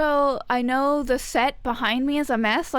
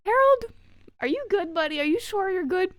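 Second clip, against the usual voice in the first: concerned calling; 7 semitones higher than usual. Acted. Worried check on Harold.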